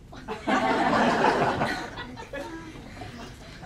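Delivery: short amused laugh; light laugh. Acted. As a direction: laugh at your own joke. Laughs after French intro.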